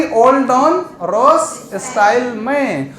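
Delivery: all डॉन रोस style में spelled out very slowly; drawn-out all डॉन रोस style में, each word apart; slow mnemonic. Shown as a demonstration. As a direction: spell out the memory trick word by word. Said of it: "क्या trick हो जाएगी हमारी?".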